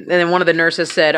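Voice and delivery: usual speaking voice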